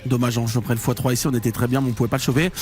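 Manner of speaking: ordinary talk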